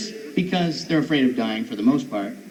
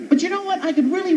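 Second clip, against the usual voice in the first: normal talking voice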